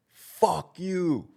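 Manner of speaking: high, stretched-out jeer; high-pitched retold yell without much volume; mock heckler yell. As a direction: imitate someone calling out an insult. Imitates the heckler's yell once more.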